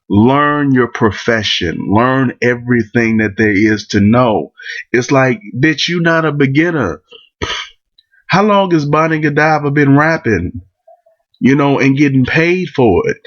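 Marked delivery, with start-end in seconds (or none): none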